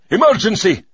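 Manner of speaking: loud high urgent shout. Acted. Fake pilot shouts to the mechanics, urgent. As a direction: bark it out like a real alarm. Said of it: "I shouted".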